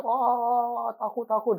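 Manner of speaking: high, panicky mock wail; retold frightened cry. Both flee the house crying they are scared, high panicky. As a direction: cry out in fear while fleeing. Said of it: "teriak-teriak".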